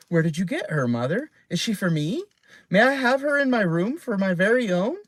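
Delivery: put-on high, pleading child; a girl begging her mother; eager little girl. A narrator's reading. Girl begs to keep parrot.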